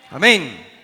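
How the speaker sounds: normal talking voice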